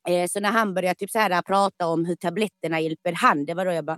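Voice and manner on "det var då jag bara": ordinary talk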